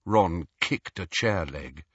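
plain reading voice

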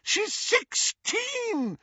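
very high, drawn-out cry; shrieked motherly protest, slow; mother's shout. A narrator's reading. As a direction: stretch it out in high-pitched outrage. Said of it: "shouted Missus Weasley".

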